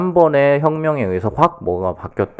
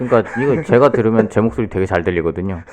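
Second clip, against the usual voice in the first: usual speaking voice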